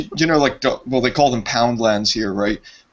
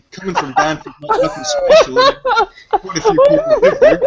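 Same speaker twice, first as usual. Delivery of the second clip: talking through laughter, much higher; half-laughing, pitched well up; 5 dB louder than usual. Real, not acted. Teasing the caller about Banff, laughing through words.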